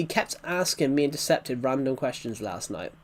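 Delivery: ordinary delivery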